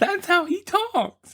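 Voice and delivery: very high, slow falsetto; high puppet voice. Acted. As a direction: stay in the squeaky puppet voice. Still in Lamb Chop voice.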